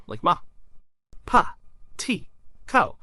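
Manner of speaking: ma, pa, ti, ko as crisp single syllables, ko almost breathed; simple syllable demo. Shown as a demonstration. Teacher showing first syllables to viewers, crisp syllable demo. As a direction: sound out ma, pa, ti, ko one by one. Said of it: "a consonant followed by a vowel".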